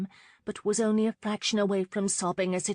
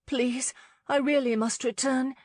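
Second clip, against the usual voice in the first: pleading; 7 semitones higher than usual. Acted. Lauren pleading to leave.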